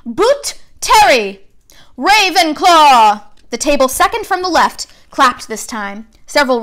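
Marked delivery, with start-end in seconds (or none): 0.0-1.5 calling out a name. A narrator's reading. Name called out at sorting. 2.0-3.2 loud, slow, drawn-out house name; hat's proclamation. A narrator's reading. Hat announces house.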